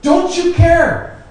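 long, high, loud cry of don't you care; drawn-out pleading shout; anguished cry. Retold or imitated. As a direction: cry it out like desperate disciples. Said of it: "Expression of doubt".

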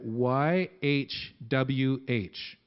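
each letter drawn out slowly; slow spelled letters. Shown as a demonstration. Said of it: "this is how they would say it".

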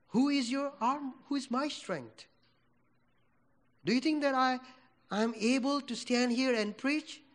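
ordinary talk

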